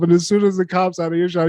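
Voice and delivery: ordinary delivery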